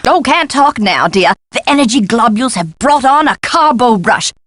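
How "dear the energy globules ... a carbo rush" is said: ordinary delivery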